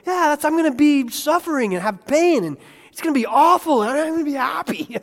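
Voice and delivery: high, loud, ironic cheer; mock cheerful. Pastor mocks how absurd joyful suffering sounds. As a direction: ham up the forced happiness. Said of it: "It's really hard to go".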